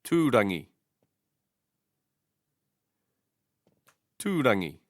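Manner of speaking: "tūrangi" stressed on a long TUU; stress first long vowel. Shown as a demonstration. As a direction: say "tūrangi" as TOO-ra-ngi. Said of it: "stress the first long vowel".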